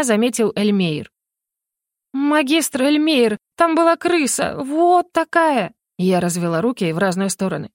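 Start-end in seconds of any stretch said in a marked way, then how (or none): 2.1-5.7 high, slow, drawn-out pleading; frightened insistence. A narrator's reading. Heroine telling the magister about the huge rat, high and pleading. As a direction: insist wide-eyed there was a rat.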